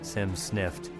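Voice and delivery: ordinary delivery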